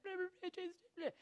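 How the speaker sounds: high, strange babbling demon voice; a squeaky mocking gibberish demon.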